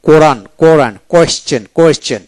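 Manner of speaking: usual speaking voice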